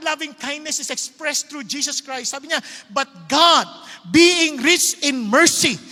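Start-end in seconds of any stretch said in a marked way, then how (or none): 2.9-5.8 full-force preaching. Acted.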